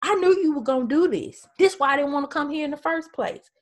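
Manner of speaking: preacher voicing Jonah's fed-up rant; exasperated Jonah. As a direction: whine it like a sulking prophet. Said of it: "he was like".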